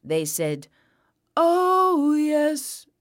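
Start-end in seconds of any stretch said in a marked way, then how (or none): 1.3-2.9 high drawn-out coo; long satisfied "oh yes"; contented doves. A narrator's reading. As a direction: sing it out, pitched up. Stuffed doves fly out.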